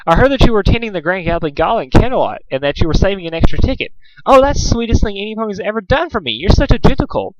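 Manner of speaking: lightly voiced gushing Rarity, fast; a hint of swooning fine lady; flowery Rarity. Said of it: "the flowery voice of Rarity".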